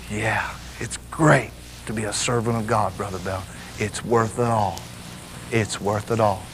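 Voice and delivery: soft, low and slowing, at peace; relaxed relief. Retold or imitated. Servant of God to visiting preacher after bracing himself, relaxed. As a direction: let it out low and soft as tension melts. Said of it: "and they relax".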